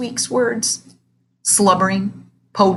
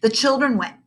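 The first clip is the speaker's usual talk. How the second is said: usual speaking voice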